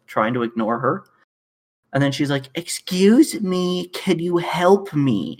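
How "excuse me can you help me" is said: high, slow, drawn-out customer voice; mock insistent lady. Lady repeats her request to the ignoring worker, high and slow. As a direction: stretch it out like an impatient shopper.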